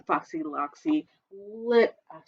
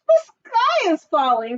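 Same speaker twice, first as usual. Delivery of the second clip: puffing; 9 semitones higher, 4 dB louder than usual. Acted. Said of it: "puffed Chicken Little".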